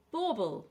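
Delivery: "Bauble" with a strong Bau, falling after; first-syllable stress. Shown as a demonstration. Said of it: "stress on the first syllable".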